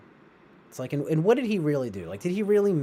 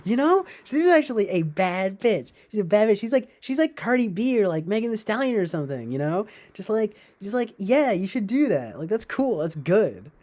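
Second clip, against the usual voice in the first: higher-pitched mock admiring fan; lightly voiced cheerleading supporter, pitched up; mock modern feminist; 7 semitones higher, 3 dB louder than usual. Host imitating feminists cheering on Eileen Wuornos, mock-approving voice. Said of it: "feminists to find out about Eileen Wuornos".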